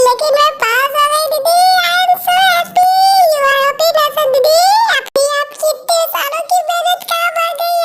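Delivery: high, stretched happy cry; bursting with the news; excited joy. Acted. Girl tells her sister she passed, overjoyed and high.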